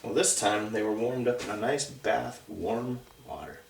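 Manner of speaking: usual speaking voice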